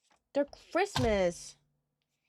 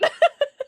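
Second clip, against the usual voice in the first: short squealing laugh; quick high laugh; high giggle; 6 dB louder than usual. Real, not acted. Laughs after reading.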